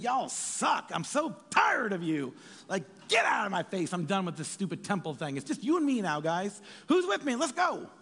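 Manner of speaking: exasperated rant rising into a cry of who's with me; mock angry rant. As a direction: ham up an exasperated Jesus rallying the crowd. Preacher voices angry Jesus.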